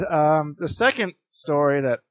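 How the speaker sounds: ordinary delivery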